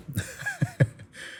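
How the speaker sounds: high bright laugh; a short high laugh at the joke. Real, not acted. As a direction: let out a real laugh. Laugh after halfway there.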